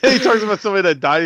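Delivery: usual speaking voice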